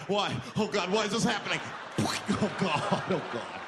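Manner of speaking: sing-song despairing whine; hammed-up wail. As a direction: whimper in mock despair. Victim despairing.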